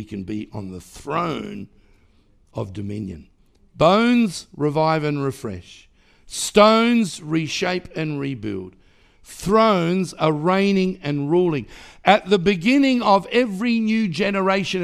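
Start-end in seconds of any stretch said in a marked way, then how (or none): 3.8-14.8 loud, slow proclamation; preaching declaration. Acted. Preacher proclaiming over the house, slow and loud. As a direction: declare each line to the church.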